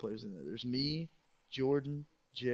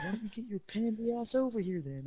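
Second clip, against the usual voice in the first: higher, mock-tough coach; gruff coach put-on; 9 semitones higher than usual. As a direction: call him over. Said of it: "And then he said".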